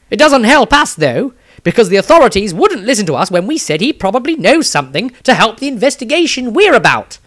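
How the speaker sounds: an anxious, quick-talking engineer mage telling what happened; nervous wizard. Acted. Maestro's diary, another figure.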